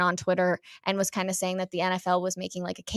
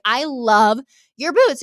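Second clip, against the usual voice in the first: gushing compliment; 3 semitones higher, 5 dB louder, slower than usual. Retold or imitated. Retold gushing compliment to stranger.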